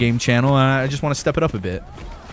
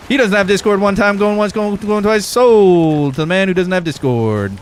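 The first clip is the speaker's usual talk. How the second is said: higher and louder, rapid auction patter; auctioneer call; 7 semitones higher, 5 dB louder than usual. Pretend. Streamer auctioning off the friend without Discord, higher and louder. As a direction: sell it off like a showman auctioneer. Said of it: "Let's have a little auctioneer off".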